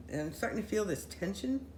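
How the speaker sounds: usual speaking voice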